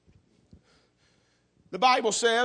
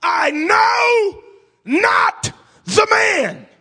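high, loud, drawn-out anguished shout; cursing cry; 14 dB louder, much slower than usual. Acted. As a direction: scream the denial, breaking. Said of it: "he begins to curse and to swear".

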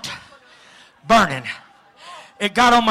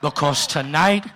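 low menace; 6 semitones lower than usual. Acted. Preacher speaking to the giants, low and threatening.

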